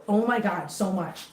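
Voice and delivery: ordinary talk, nothing marked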